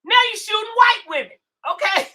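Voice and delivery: sudden loud, fast yell at a viewer; angry shout. Real, not acted. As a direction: blast it out in real anger. Angry rant at a commenter.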